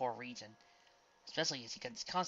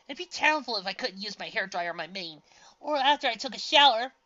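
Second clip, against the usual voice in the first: whining; 9 semitones higher, 12 dB louder than usual. A narrator's reading. Whined complaint.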